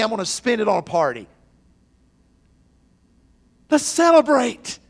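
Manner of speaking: loud, high-pitched preacher's proclamation; full-force preaching. Acted. Preacher telling the church of the found sheep, full-force preaching. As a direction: preach it out with big celebratory force.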